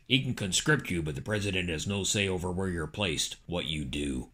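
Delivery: low, quiet calculating politician; Dick Cheney. Acted. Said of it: "Cheney said".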